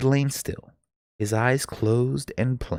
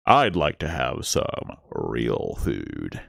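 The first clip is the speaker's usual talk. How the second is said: slow, lower, grumbling tired man; low slow grumble; 9 semitones lower, much slower than usual. A narrator's reading. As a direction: drag it out like a fed-up scientist. Scientist grumbling at stale sandwich.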